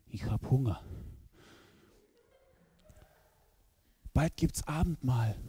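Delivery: a hungry friend whispering in church; Russian-accented Viktor. Retold or imitated. Viktor mit Akzent. (leise, tief, mit russischem Akzent)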